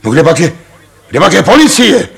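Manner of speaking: high, loud and fast cry for help; loud, rushed call for the police; calling out loudly. Acted. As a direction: yell over the crowd for the police. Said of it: "volal do toho Ostrohradský".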